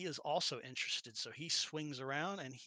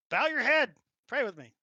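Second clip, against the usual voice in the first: urgent priestess; 9 semitones higher, 11 dB louder than usual. Acted. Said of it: "She's like".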